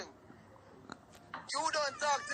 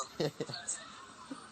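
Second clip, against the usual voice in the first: breathy gloating laugh; 6 semitones lower, 7 dB quieter than usual. Acted.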